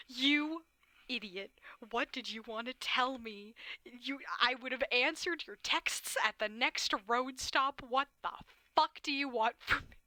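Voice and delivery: angry outburst. Acted.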